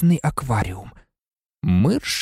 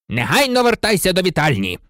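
thundering order; 6 semitones higher than usual. A narrator's reading. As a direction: order the boy away. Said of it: "гримнув Філч".

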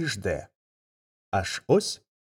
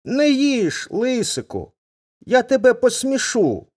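much higher, small bird voice; pleading little thrush; 11 semitones higher than usual. Acted. Thrush begging the fox to spare her chicks, high and pleading.

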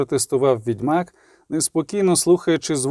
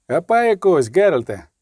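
raised, urgent call to a comrade; mild shout; 3 semitones higher, 3 dB louder than usual. A narrator's reading. Kahir urging the witcher to take a horse, mild shout. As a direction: call out the order, slightly lifted. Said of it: "крикнув Кагір".

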